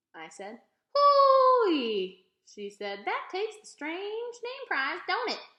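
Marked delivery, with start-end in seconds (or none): 0.9-2.3 very high, loud hoot; an old woman's loud, high hoot of amazement; hooting exclamation. A narrator's reading. 3.1-5.5 put-on high, slow chuckling old lady; a high, slow old woman amused. A narrator's reading. Old woman teasing about name.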